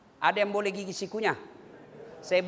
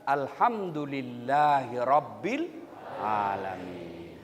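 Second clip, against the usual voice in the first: prayerful recitation; 12 semitones lower, 5 dB quieter, much slower than usual. Shown as a demonstration.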